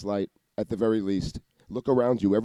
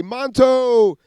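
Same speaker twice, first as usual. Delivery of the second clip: high, louder mock call of the name; imitated voice; 12 semitones higher, 6 dB louder than usual. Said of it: "sometimes they'll say".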